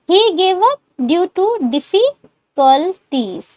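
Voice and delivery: very slow, each word spaced; slow model sentence. Shown as a demonstration. Teacher dictating full sentence slowly to class.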